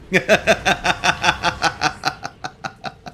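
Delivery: drawn-out run of chuckles, trailing away; long amused laugh. Real, not acted. Speaker amused by the East living on in him, long chuckling laugh. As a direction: laugh at your own quirk.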